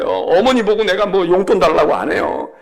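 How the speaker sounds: usual speaking voice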